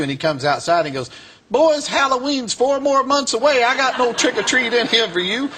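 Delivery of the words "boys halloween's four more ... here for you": mock-scolding high voice; imitated reverend's retort. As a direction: call out high and unhurried, imitating him. Reverend's retort to hooded Klansmen.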